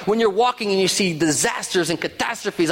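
ordinary talk

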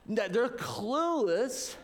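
ordinary talk, nothing marked

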